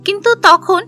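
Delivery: plain reading voice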